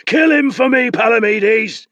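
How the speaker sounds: high, loud furious yell; desperate yell at the rower. Acted. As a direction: scream the order across the water. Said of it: "Nabor yelled".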